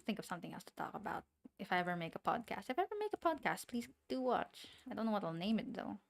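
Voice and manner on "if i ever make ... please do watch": high-pitched, louder playful appeal; playful plea. Real, not acted. Streamer asking chat to watch a future podcast, high playful plea. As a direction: plead sweetly, pitched way up.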